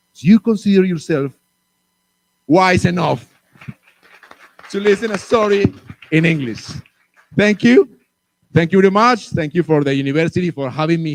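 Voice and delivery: rally the audience with gratitude; loud thanks. Real, not acted.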